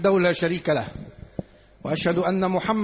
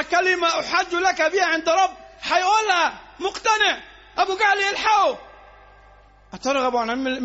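higher, louder pleading sermon voice; impassioned preaching; 6 semitones higher, 4 dB louder than usual. Acted. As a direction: build the story to a passionate peak. Retelling Abu Talib's death, building. (الخطيب يروي قصة أبي طالب بحماس وصوت مرتفع)

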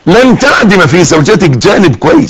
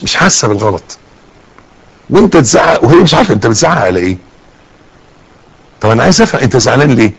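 ordinary delivery

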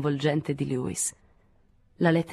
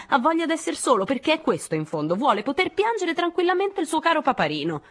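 high, heated tirade in surges; indignant, high-pitched ranting; angry yelling; 10 semitones higher than usual. A narrator's reading. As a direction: rail at the absent man, pitch leaping up. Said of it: "continuò a gridare".

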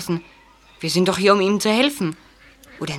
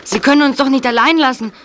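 anxious protest; 7 semitones higher, 4 dB louder than usual. Acted. Hall leaves boys alone.

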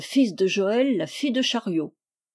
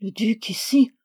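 a whispered aside to himself; whispered thought; 2 semitones higher than usual. Acted. Jeannin musing to himself, whispered. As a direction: breathe it under the breath, unvoiced.

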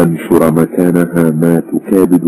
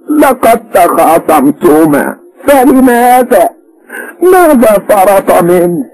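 much higher, slow, louder prying; questioning mother; 3 dB louder, slower than usual. A narrator's reading. Mother presses her daughter about the quarrel, slow and insistent.

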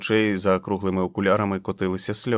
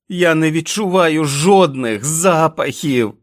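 much higher and louder, slow shriek; 7 semitones higher, 8 dB louder, slower than usual. Acted. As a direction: scream it out in fury. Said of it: "заверещав Джек". (пронизливий, повільний вереск)

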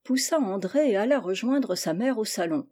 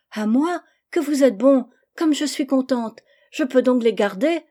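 put-on higher, louder delighted little girl; child bursting with happiness; joyful exclamation; 4 semitones higher, 5 dB louder than usual. A narrator's reading. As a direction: cry out in overjoyed thanks. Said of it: "s'écria Gisèle en se levant et en se jetant dans ses bras".

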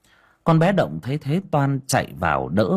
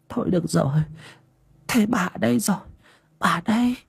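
comforting granny; 9 semitones higher, faster than usual. Acted. Grandmother comforting her sobbing granddaughter, tender. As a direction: soothe the crying child gently.

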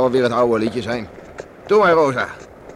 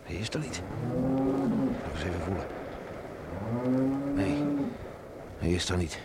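low, much softer muttering to himself; hushed dismay; 4 semitones lower, 11 dB quieter than usual. Acted. The father finding his son's bed empty at night, hushed and worried. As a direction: murmur in quiet worry over the empty bed.

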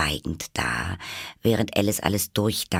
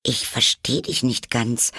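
higher, carefully polite voice; 6 semitones higher than usual. A narrator's reading. Alice admits confusion to the Hatter, polite. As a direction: put it as politely as possible. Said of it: "so höflich sie konnte".

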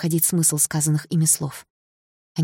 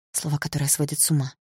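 usual speaking voice

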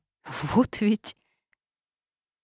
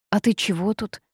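usual speaking voice

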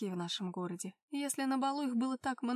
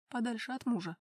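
ordinary delivery

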